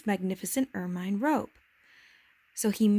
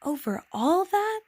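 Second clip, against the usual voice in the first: much higher, slow, wondering rise; amazed question; 9 semitones higher, 4 dB louder than usual. A narrator's reading. Little prince asked about planets and stars, amazed. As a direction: stretch it into an astonished question. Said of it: "asked the little prince".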